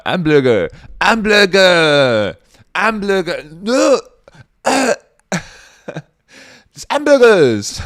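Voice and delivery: high, loud, mangled word blurted out; mock struggle to pronounce, yelled; hammed-up shouting. Mock struggle saying hamburger, shouted.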